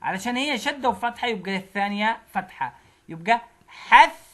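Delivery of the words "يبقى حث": slow, loud syllable split of حث; syllable demo. Shown as a demonstration. Teacher sounding out حث for kids, loud and slow. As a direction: sound out حث as حَثْ then ثَ. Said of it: "حث بتساوي حث".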